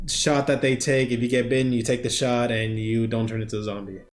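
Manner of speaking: usual speaking voice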